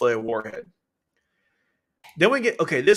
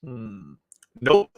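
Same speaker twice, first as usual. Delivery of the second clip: mock curt refusal; 3 semitones lower than usual. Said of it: "Schneisel like".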